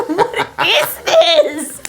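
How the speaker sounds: high, loud, slow question through laughter; drawn-out laughing protest; laughing disbelief. Retold or imitated. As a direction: exclaim in amused bafflement. Acting her own baffled laughing reaction.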